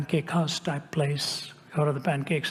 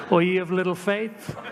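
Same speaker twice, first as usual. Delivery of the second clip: higher, louder, laughing through the line; laughing self-rebuke; 4 semitones higher, 4 dB louder than usual. Real, not acted. Preacher laughingly scolding himself for doubting. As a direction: tease yourself through laughter. Mocks own nervousness.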